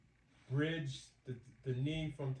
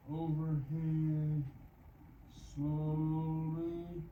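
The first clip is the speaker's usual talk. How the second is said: very drawn-out, elongated words; slow exercise cue; much slower than usual. Real, not acted. Exerciser guides a slow downward pull, drawn out. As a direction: stretch each word to match a slow pull.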